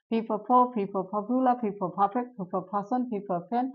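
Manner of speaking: even, repetitive list with the same lilt each time; pronunciation practice drill. Shown as a demonstration. Teacher showing students how to practise P sounds, chanted drill. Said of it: "এটা আমরা practice কিভাবে করব?".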